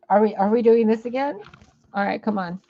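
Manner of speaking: usual speaking voice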